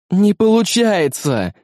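high, drawn-out cry of frustration; distressed exclamation. A narrator's reading. Rada failing at the console, high-pitched exclamation. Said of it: "воскликнула она через мгновение".

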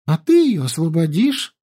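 put-on high, chirpy girlish voice; chirping. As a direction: chirp it sweetly. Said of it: "прощебетала Янди".

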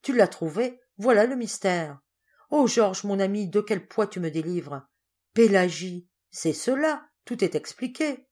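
elated outburst of sudden understanding; exclaiming. A narrator's reading. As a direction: cry out as if a weight is lifted. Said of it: "s'écria monsieur Dormer".

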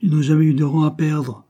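high and loud, full of disdain; Henry sneering at the Dare family, loud; contemptuous. A narrator's reading. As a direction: dismiss them with scorn. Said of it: "avec mépris".

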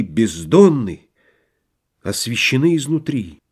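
plain reading voice

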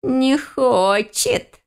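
high, very drawn-out little creature; thin giggly voice. A narrator's reading. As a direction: pipe it teasingly in a tiny voice. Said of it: "хихикнул тонкий голосок сверху".

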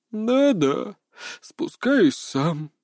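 slow, drawn-out grudging consent; sighing resignation. A narrator's reading. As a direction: sigh the words out wearily. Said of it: "вздохнул рыжий здоровяк". (с тяжёлым вздохом, нехотя)

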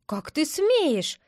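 slow, indignant cry; angry squirrel. A narrator's reading. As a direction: scold the kitten, drawn out. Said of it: "закричала белка". (возмущённый окрик белки)

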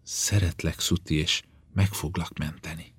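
soft, intimate love vow; tender murmur. Acted. Woman to her weakened lover, promising rescue, tender and hushed.

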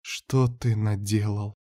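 slow, hushed despairing question; helpless dismay. Acted. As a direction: ask it softly and slowly, stunned.

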